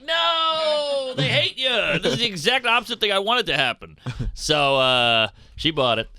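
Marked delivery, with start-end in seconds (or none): 0.0-4.0 very high-pitched squeal of dismay; high mock panic. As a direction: shriek it up high in alarm. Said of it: "And I was like".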